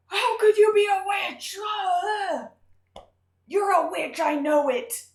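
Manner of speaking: high, loud mock outraged shriek; hammed-up hysterical parent screaming. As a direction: shriek the accusation like a panicked parent.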